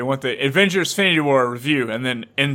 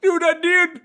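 high, slow, sing-song showman voice, much louder; mock red carpet host; 3 dB louder, slower than usual. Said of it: "You're like".

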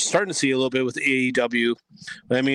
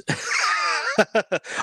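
high laugh. Real, not acted. Joking he is not really there.

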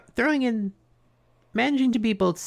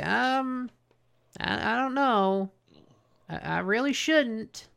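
mock coy hesitation; 6 semitones higher, 4 dB quieter, slower than usual. Retold villain hesitating at temptation.

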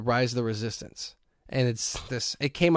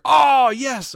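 high, loud triumphant cry; joy at getting a ticket; high delighted exclaim; excited yes; 10 semitones higher, 6 dB louder than usual. Retold or imitated. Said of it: "you hear".

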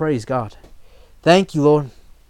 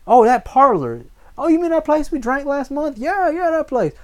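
high-pitched mock bar regular, voice lifted in recognition; excited former drinker; 11 semitones higher, 4 dB louder than usual. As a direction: play a drinker suddenly remembering the place, pitched way up. Voicing former bar patrons recalling parlor.

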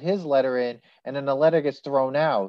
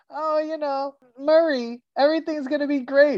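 much higher, bright reassuring wife voice; chirpy wife; 11 semitones higher than usual. Retold or imitated. Host imitates the wife soothing her husband Murray, high and chirpy. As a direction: sing it out to calm Murray. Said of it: "She's just like".

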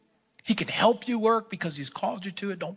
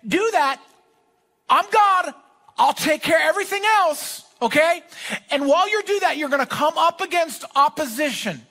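high, loud coach-style preaching; rally the room like a head coach; preaching at full force; 9 semitones higher, 5 dB louder than usual. Acted. Preacher shouting God's game plan to the congregation like a coach.

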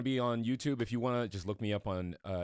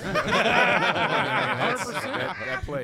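laughing; 12 semitones higher, 9 dB louder, much slower than usual. Real, not acted. Laughing at his joke.

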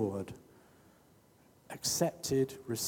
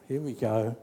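usual speaking voice

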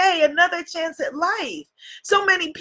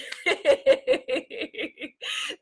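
high giggle; 8 semitones higher, 5 dB quieter than usual. Real, not acted. Laughs after mock fright.

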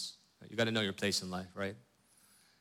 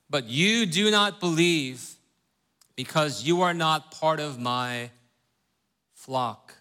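reading the verse aloud, higher and louder, slowing at the end; scripture reading; 5 semitones higher, 4 dB louder than usual. Acted. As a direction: lift the Bible text out of the teaching talk.

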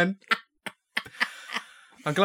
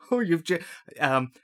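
ordinary talk, nothing marked

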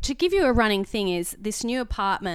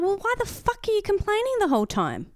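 exasperated retort; 10 semitones higher than usual. Retold or imitated.

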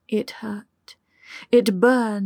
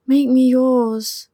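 slow, louder desperate plea; pleading; 3 semitones higher, 5 dB louder, slower than usual. A narrator's reading. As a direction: beg slowly, voice straining. Lover pleading during sex.

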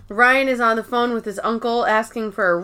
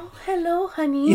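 higher, softer sweet greeting; soft-spoken old lady; 7 semitones higher, 5 dB quieter than usual. Retold or imitated. Imitating the soft-spoken actress greeting someone, high soft coo. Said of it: "very soft-spoken".

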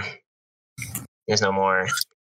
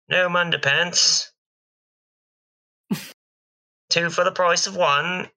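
market hawker; 8 semitones higher than usual. Acted. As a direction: hawk the wares in a high voice. Goblin hawking underpants.

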